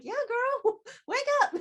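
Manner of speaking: put-on high, laughing yeah girl wake up; laughing tease. Teasing friend, laughing.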